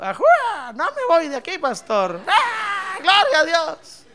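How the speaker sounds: high, whooping imitation of a drunk believer; mock drunken yelling. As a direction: holler like a happy drunk, hammed-up. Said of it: "ahí lo mira usted gritando". (imitando gritos de borracho eufórico)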